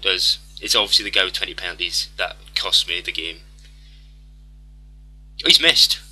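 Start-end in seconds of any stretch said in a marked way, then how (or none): 5.4-6.0 sudden loud, higher gasp of hope; surprised cry. Real, not acted. Gamer seeing the opponent miss, surprised loud cry. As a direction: blurt it out as the keeper saves.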